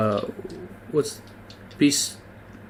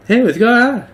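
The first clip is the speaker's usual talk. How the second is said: put-on high, loud little boy voice; squeaky little kid greeting; Little Timmy voice; 11 semitones higher, 6 dB louder than usual. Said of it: "he doesn't have a Little Timmy voice".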